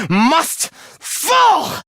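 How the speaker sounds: high, furious battle cry; fanatical shout. Acted. Villain declaring humanity's doom, fanatical shout. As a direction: shout it high as a death sentence.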